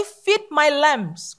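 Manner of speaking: feed my lambs stretched very slowly; very slow recap. Shown as a demonstration. Preacher recalling the first command slowly for the church. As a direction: draw it out word by word.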